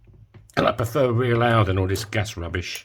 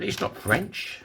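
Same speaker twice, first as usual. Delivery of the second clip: put-on girlfriend's voice; 9 semitones higher, 4 dB quieter, much slower than usual. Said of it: "Until my girlfriend said".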